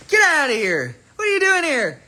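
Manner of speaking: high, squeaky playful disbelief; hammed-up surprised greeting; mock astonishment. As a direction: exclaim it way up high, teasing. Playfully reacting to someone turning up.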